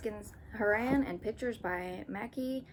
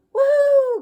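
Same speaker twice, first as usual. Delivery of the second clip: very high loud joyful whoop; 17 dB louder than usual. Acted. Arthur riding the river, very loud high whoop. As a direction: whoop with glee. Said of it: "cried Arthur".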